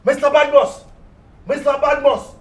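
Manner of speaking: loud call at the door. Acted. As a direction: shout the name through the door. Creditor calling at door.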